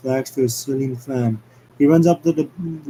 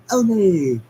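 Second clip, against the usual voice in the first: triumphant olé; 6 semitones higher, 3 dB louder than usual. Acted. Sonic's triumphant flourish.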